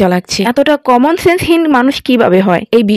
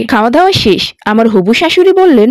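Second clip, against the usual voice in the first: ordinary delivery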